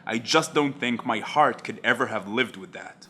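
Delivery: low, understated dramatic recital; Jack Bauer. Retold or imitated. Jack weighs the laws against saving people, lightly acted, low. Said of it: "He then goes on to talk about the conflict".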